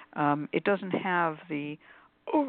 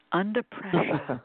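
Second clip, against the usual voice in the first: slow, louder mock New York accent on "under pressure"; 6 dB louder, slower than usual. Host teasing the guest by copying his New York accent. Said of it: "I love your New York accent".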